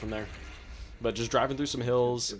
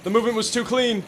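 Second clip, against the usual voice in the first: loud, pompous gentleman; mock gentleman duelist; 10 semitones higher, 8 dB louder than usual. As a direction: boast like a courtly duelist. Missed shots, gentleman voice.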